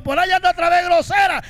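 hammed-up defiant reply; mock shout. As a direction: imitate a mouthy teen snapping. Quoting rude daughter.

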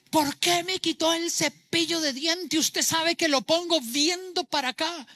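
high-pitched hammed-up domestic outburst; mock indignant complaint. Acted outburst over a toothbrush. (queja indignada fingida, en tono agudo)

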